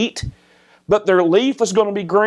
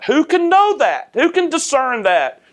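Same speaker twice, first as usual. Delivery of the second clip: much higher, pleading question; high rhetorical cry; 6 semitones higher than usual. Acted. Preacher challenges the congregation, high rhetorical cry.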